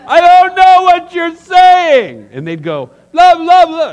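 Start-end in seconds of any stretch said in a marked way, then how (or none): 0.1-2.3 slow, very loud high shout; hammed-up drawn-out yell; yelling back. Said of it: "some people would yell at me". 3.1-3.9 very high, loud gibberish shout; hammed-up escalating yell; even louder yelling. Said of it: "it's just get louder".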